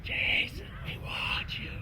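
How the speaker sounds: faint eerie whisper; skunks whispering. Acted. Falling skunks still whispering Jason's name, faint eerie. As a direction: hiss it as it fades away. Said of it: "I could still hear".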